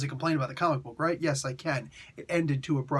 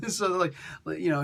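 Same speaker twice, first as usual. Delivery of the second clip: ordinary talk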